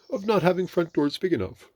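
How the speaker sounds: high, loud cross complaint. A narrator's reading. Pooh stuck and blaming Rabbit's door, cross. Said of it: "said Pooh crossly".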